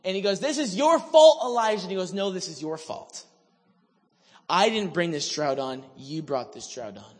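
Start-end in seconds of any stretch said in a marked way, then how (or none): 0.4-1.8 high, loud mock accusing; acted accusation. Accuser blaming Elijah for the drought, loud and high. 2.1-7.1 lowered, controlled comeback; firm retort. Retold or imitated. Elijah answering his accuser over the drought, low and firm.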